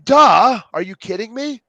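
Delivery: loud, high mocking duh; incredulous duh. Speaker reacting to clueless teachers, loud incredulous scoff. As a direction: scoff in disbelief. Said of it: "And I'm like".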